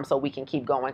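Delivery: normal talking voice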